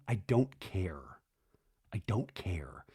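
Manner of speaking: low, slow near-whisper; mock-hurt whispering; retold hushed retort. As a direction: let it drop to a defeated whisper, imitating him. Retelling Jesse's tearful retort.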